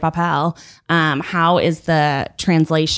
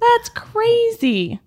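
mock outrage; much slower than usual. Echoing again.

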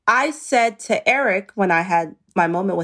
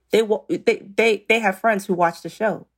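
usual speaking voice

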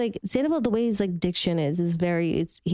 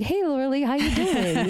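higher, chirpy hello; friendly message greeting; 5 semitones higher than usual. Retold or imitated. Co-star reading out her message to former castmate, friendly greeting. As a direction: read out your friendly message. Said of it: "a message being like".

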